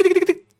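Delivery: high, rapid ticking noises; imitated typing. Clerk starting to look up the debt, in high mimicked ticks. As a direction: tick away like keys being hit. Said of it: "Y empieza, tic".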